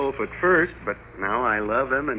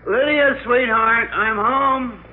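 high, loud call to his wife through the house; 7 semitones higher, 3 dB louder, slower than usual. Acted. Husband calling out to his wife as he walks in the door, loud and high. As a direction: call her name across the house.

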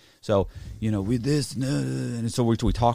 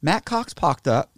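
mock surprise; 10 semitones higher, 4 dB louder, slower than usual. Mocking investigators spotting his name.

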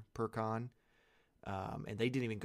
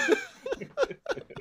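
sudden loud cackle; loud laugh; 10 dB louder than usual. Real, not acted. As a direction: burst of loud high laughter. Reacting to puberty joke.

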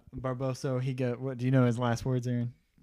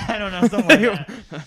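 laughing; 7 semitones higher, 10 dB louder, faster than usual. Real, not acted. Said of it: "listener laughs".

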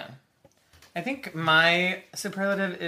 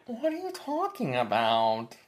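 Lisa voice. Retold or imitated. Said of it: "It's like".

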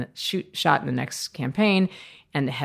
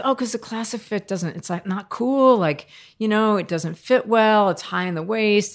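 put-on eager young self; 6 semitones higher than usual. Arguing eagerly with boss.

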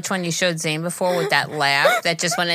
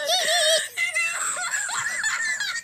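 high squealing laugh like air escaping; squeaky balloon laugh; 6 dB quieter than usual. Real, not acted. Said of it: "She sounds like a balloon".

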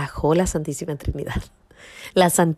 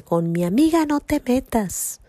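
higher-pitched retold warning; advertencia desafiante; 8 semitones higher, 3 dB louder than usual. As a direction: imitate a woman fiercely stepping in to defend a friend. Muchacha defiende a la narradora. (advertencia desafiante imitada, en tono agudo)

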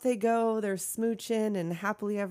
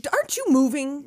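louder, higher impatient prodding; mock impatience; 6 semitones higher, 6 dB louder than usual. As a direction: imitate a fed-up bar owner hurrying someone. Acts out the annoyed bar owner.

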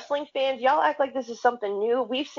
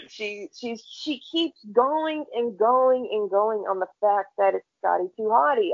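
ordinary talk

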